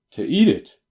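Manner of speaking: loud, high, startled cry; a man aghast that his goose was eaten; shocked outburst. A narrator's reading. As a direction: blurt it out in disbelief. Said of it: "half rose from his chair in his excitement".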